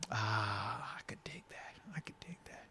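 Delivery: soft unvoiced whisper. Real, not acted. Host whispers approval to his co-host. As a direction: say it under your breath, almost silent.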